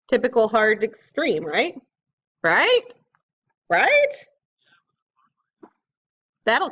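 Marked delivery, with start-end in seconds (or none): 2.4-2.8 "Right" with a sharp upward sweep; insistent question. Real, not acted. Player seeking reassurance about the hit, "Right" rising insistently. 3.7-4.1 "Right" swooping up very high; anxious insistence. Real, not acted. Player desperate for reassurance, "Right" shooting up high. Repeats higher, seeking reassurance.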